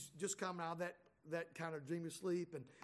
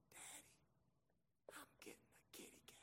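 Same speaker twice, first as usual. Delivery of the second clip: whisper; 24 dB quieter, slower than usual. Retold or imitated. Drowsy daughter after surgery.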